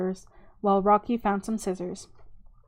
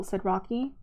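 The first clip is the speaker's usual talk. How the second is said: plain reading voice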